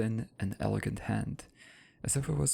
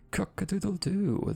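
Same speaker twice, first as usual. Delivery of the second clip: high crowing call; rooster crow; 9 semitones higher than usual. Acted. Rooster crows.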